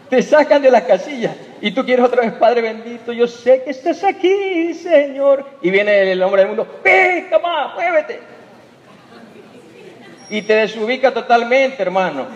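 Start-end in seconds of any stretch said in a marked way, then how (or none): 2.3-5.4 high, slow, sweetly devout prayer; mock pious prayer. Driver praying calmly to God, mock devout and high. 6.8-8.2 high, pushy yell at another car; mock angry driver. Road-rage driver yelling at the believer, mock angry and high.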